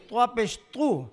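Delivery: normal talking voice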